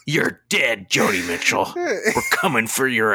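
hammed-up raging threat, last words stretched way out; mock tirade. Host pretending to threaten to cancel [name], mock fury.

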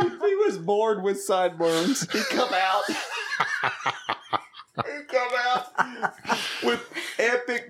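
words broken by laughter; laughing. Real, not acted. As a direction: laugh through it. Laughing while teasing friend.

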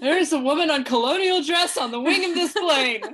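high, loud mock-panicked outburst; Annie. Host quoting Bridesmaids to co-host, high loud mock panic. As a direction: raise a hammed-up alarm, laughing by the end. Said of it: "identify with Annie".

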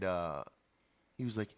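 usual speaking voice

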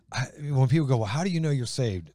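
normal talking voice

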